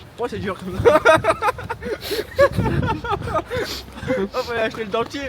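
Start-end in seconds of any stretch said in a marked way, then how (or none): 1.1-4.3 shrieking laugh up high; high burst of laughter. Real, not acted. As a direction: cackle high and loud. Hard candy surprise.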